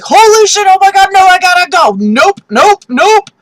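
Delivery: panicked high loud yelling; hammed-up terrified outburst; freaking out. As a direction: scream it like you must run now. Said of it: "that was a freak out".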